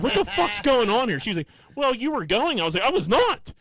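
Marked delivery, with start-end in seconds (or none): 0.0-1.2 high, loud, drawn-out mock outrage; acting out an exasperated protest; indignant retort. Retold argument with mother. 1.7-2.6 high, loud mock bickering; retold argumentative mother. 2.8-3.5 high, loud mock protest; indignant denial. Retold argument with mother.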